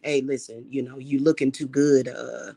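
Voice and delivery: low, smooth man's voice, quick at the end; imitating Bum Doc. Bum Doc on the phone telling her to stop dressing up, in a low imitation. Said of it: "he said".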